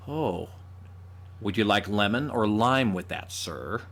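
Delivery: put-on American waiter voice; American-accented server taking an order. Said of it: "waiters that are serving me".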